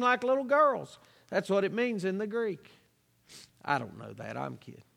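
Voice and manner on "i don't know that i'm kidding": much lower and softer, thrown away; joking aside. Real, not acted. Preacher admits to congregation he was kidding, low joking aside. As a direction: drop right down for an offhand admission.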